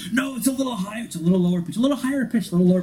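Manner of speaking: high, fussy nerd quibbling over pitch; bickering nerds. Retold or imitated. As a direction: imitate nerds correcting each other, pitch hopping up and down. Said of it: "all the freaking nerds in there doing the different warp core sound effects".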